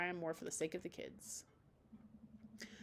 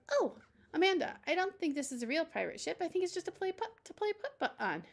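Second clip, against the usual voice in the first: put-on high child's voice; a little girl correcting her friend; Daisy's voice; 10 semitones higher, 4 dB louder than usual.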